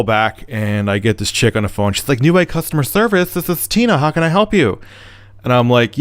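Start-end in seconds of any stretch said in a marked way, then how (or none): 2.2-4.8 high-pitched customer service greeting; imitated receptionist. Customer service rep answers the caller, imitated receptionist. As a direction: put on a higher phone-greeting voice.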